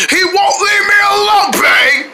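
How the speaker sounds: high shouted plea; calling for help. Acted. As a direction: yell for babe.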